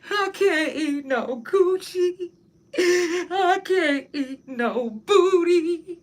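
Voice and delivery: slow high hammed-up wail; mock crying. Mock wailing over surgery diet.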